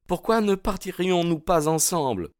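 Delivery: put-on high-pitched young woman; Etty, pleading. A narrator's reading. Young woman asks suitor to leave with her, high pleading voice. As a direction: plead gently in a high female voice.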